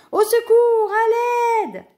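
very high, loud desperate wail; cry for help. A narrator's reading. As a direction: scream for rescue at full voice. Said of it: "qu'un cri retentit".

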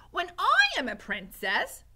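put-on high, loud serving-girl voice; loud boasting. A narrator's reading. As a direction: announce it far too loudly. Mig too loud on secret mission.

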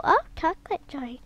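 ordinary delivery

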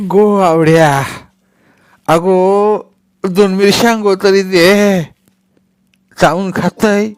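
loud, high, slow pleading crone; begging old woman. A narrator's reading. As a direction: whine loudly like a toothless old hag for chillies. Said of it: "मसनी मिरच्या मागते".

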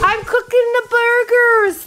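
high, slow attempt at Welsh that lands in Somerset; botched Welsh accent, drifting into Somerset, drawn out; terrible Somerset-like accent. Shown as a demonstration. Vlogger announcing burgers, terrible Somerset-sounding accent. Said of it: "That was a terrible accent. That was like Somerset".

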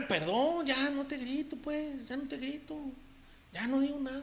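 soft, high, shrinking apology; meek backing down. Acted. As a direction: fade away like a scolded husband. Backs down after yelling at wife. (ya no te grito, cada vez más bajito y sumiso)

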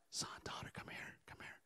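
put-on God whispering to his children; barely audible, beckoning whisper. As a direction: whisper to draw someone close. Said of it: "why are you whispering to me".

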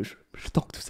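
whispered through a giggle; laughing whisper. Real, not acted. Quoting his macho line to viewers, laughing whisper. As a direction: breathe it out laughing.

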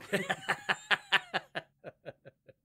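loud burst of laughter; big laugh. Real, not acted. Host laughing at own joke about the presenter, loud cackling. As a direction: crack up loudly at your own joke.

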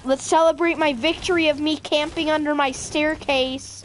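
loud mock victory announcement; mock calling out. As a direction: call him in from another room. Mock calling Jake to celebrate.

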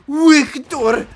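mock heavy German accent shouting. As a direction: bark the name like a heavy German. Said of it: "heavy German accents".

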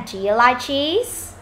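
"Do you like cheese" drawn out, lifting on "cheese"; slow question, voice climbing at the end; rising question tune. Shown as a demonstration. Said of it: "Lên giọng nào?".

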